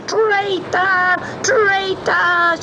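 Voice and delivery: high, drawn-out cries of rage; the witch howling as she vanishes; furious cry. Acted. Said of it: "she cried".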